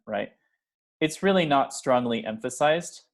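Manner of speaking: ordinary talk, nothing marked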